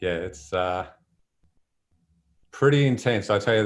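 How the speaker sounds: ordinary delivery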